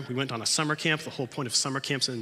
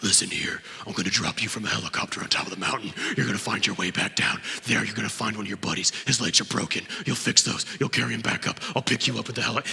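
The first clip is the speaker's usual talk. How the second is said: gruff leader voice; 3 dB louder than usual. Retold or imitated. Scout leader voiced in story.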